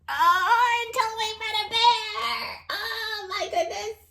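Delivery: high, excited storybook alarm; mock alarm. Reading to a child, hammed-up high squeal at the bear. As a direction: squeal it like a scary surprise.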